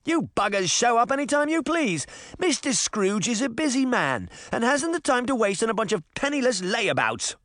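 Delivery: put-on high, bossy English-accented rant; scolding English boy. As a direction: tell off the crowd in a thick English accent. Tim scolds the crowd.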